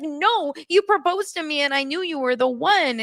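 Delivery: high-pitched mock protest; insistent fiancée. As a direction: lightly imitate her indignant insistence. Retold fiancée insisting in quarrel.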